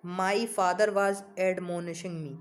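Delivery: example read out very slowly; slow example sentence. Shown as a demonstration. Teacher giving students an example sentence, slowly. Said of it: "कि भाई".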